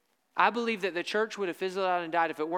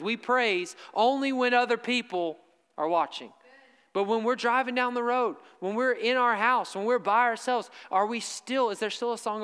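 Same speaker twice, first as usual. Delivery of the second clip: louder, higher preaching at full force; raised revival preaching voice; fiery preaching; 4 semitones higher, 4 dB louder than usual. Acted. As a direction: preach it out to the congregation.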